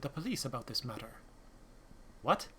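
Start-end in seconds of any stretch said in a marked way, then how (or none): none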